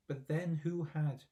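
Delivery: ordinary delivery, nothing marked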